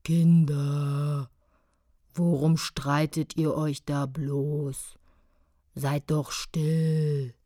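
low, slow gurgling elephant; gurgling rumbling voice. A narrator's reading. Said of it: "eine gurgelnde Stimme grollend".

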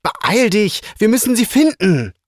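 high, urgent calling to the dragon. A narrator's reading. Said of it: "rief er seinem Drachen zu".

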